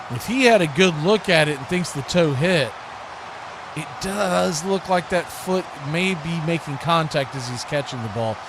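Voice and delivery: ordinary talk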